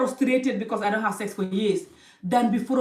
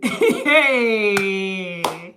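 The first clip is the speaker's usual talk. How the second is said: a loud, helpless laugh that breaks out mid-lecture; real laugh; 7 dB louder than usual. Real, not acted. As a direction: laugh out loud despite the sad topic. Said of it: "I don't know why I'm laughing".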